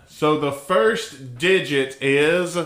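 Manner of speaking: 非常にゆっくりと読み上げる口調; slow, deliberate dictation; dictating. Retold or imitated.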